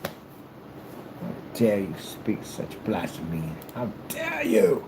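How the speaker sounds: slow, hushed mock indignation rising; drawn-out gentlemanly affront; affronted nobleman. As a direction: seethe with theatrical disbelief. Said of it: "He goes".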